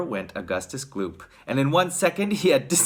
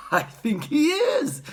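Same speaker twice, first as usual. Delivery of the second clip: put-on high, slow old-man voice; piping, drawn-out grandpa; Grandpa Joe; 13 semitones higher, slower than usual. A narrator's reading. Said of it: "said Grandpa Joe".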